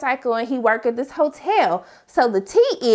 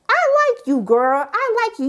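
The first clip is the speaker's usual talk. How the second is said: cheering approval; 10 semitones higher than usual. Retold or imitated. Cheering Angela's stand.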